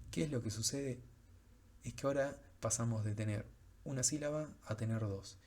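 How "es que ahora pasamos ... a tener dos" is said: ordinary talk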